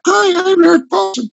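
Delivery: high-voiced self-introduction from old videos. Shown as a demonstration. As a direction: pitch it way up, like your old voice. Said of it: "a little bit higher".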